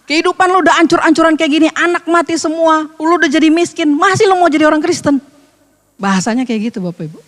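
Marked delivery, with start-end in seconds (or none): 0.1-5.2 Betawi slang taunt. Retold or imitated. Preacher voicing Job's wife in Betawi slang, mild mocking taunt. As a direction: jeer at him Betawi style, lightly pushed. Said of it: "kalau kata orang Betawi".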